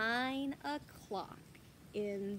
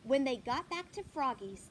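plain reading voice

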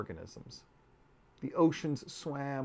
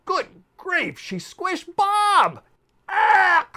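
much higher, loud squeaky cry; outraged insect shriek; 13 dB louder, much slower than usual. Acted. Insect shrieking after Bob gets squished, high and loud. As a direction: shriek in tiny indignant horror.

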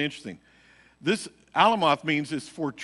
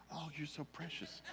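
mock doting voice; 3 semitones higher, 18 dB quieter than usual. Mocking a clingy man hug.

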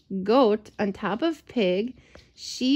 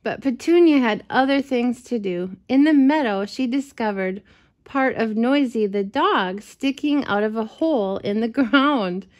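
plain reading voice

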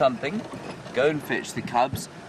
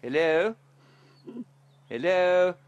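calling out; 9 semitones higher, 4 dB louder, slower than usual. Real, not acted. Calling into a warthog burrow.

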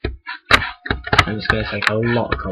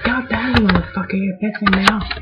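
mock angry; 10 semitones higher than usual. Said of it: "It means".

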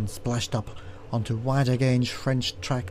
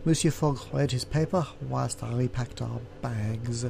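plain reading voice

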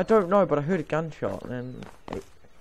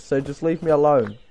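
ordinary delivery

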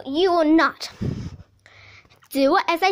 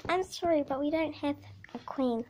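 normal talking voice

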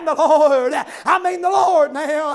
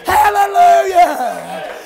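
high, loud, drawn-out hallelujahs; preaching at full force; 4 semitones higher, 3 dB louder than usual. Acted. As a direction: ring it out to the back pews. Sermon climax, shouted hallelujahs.

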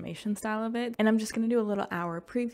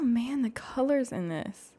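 ordinary delivery

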